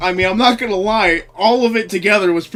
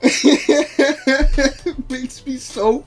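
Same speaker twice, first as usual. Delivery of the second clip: much higher, very slow, words squeezed between laughs; real wheezing laughter, louder at the end; helpless laughter; 7 semitones higher, 6 dB louder, much slower than usual. Real, not acted. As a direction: laugh so hard the line falls apart. Repeating quote while laughing.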